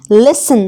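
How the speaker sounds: लिसन with a silent T; correct pronunciation. Shown as a demonstration. Teacher modelling the word, लिसन with no T. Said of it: "लिसन पढ़ेंगे".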